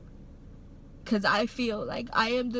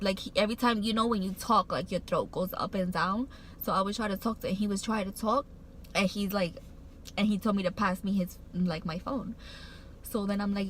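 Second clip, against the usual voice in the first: ordinary talk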